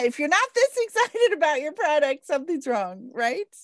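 laughing while she talks. Real, not acted. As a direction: giggle through the line. Laughing about her program.

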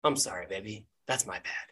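low, soft and chastened; sheepish apology. Acted. Gnome apologizes to his partner, small sheepish voice. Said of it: "Dan sort of looks down and goes".

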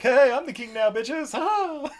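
high, cocky mock taunt ending in a laugh; gloating villain. Nephew gloating after shooting the king, in a high mock crow. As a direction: crow it like a petty brat grabbing the crown.

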